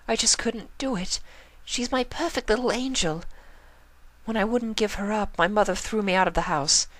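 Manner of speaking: high, refined pony voice, sad; Rarity voice. Acted. Rarity telling Pinkie her past, high and sorrowful. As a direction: tell a painful story, high and hurt.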